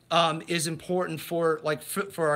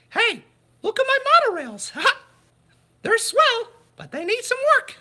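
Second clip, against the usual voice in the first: high falsetto cartoon mouse voice, laughing and chirpy; cheery high Mickey voice gushing about money; Mickey Mouse impression; 5 dB louder than usual. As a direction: squeak it out like Mickey, giggling between lines. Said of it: "I butchered my Mickey impersonation".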